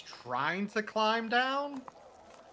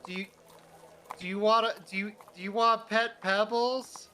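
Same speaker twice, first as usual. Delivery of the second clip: put-on hesitant child's voice, pitched up; shy kid; 4 semitones higher than usual. Young boy twisting his heels offers a pet, shy halting voice. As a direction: stammer the offer in a higher little-boy voice. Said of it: "twist his heels and says".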